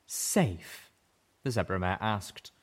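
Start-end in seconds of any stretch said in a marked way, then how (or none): 0.0-0.9 bitter laugh. A narrator's reading. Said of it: "her voice cracking with a bitter laugh".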